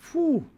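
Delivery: high-pitched exhaled whew; amazed whew. Real, not acted. Reacting to many goals.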